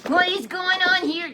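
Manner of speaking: high, loud furious demand; outraged demand. Acted. Athaliah bursts in on the crowning, a high outraged shout. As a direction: shout it like a queen who finds a plot. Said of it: "That's what she was saying".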